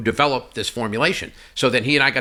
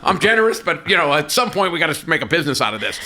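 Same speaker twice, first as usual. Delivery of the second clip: louder, higher, emphatic reality check; retold exasperation, pitched up and punchy; exasperated businessman; 5 semitones higher, 4 dB louder than usual. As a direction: spell it out loudly to a naive partner. Acted pep talk to naive partner.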